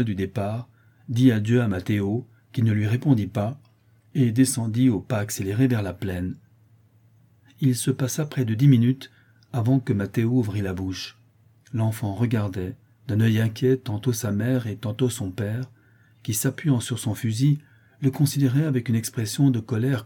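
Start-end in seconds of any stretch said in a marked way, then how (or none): none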